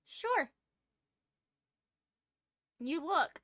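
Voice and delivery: ordinary delivery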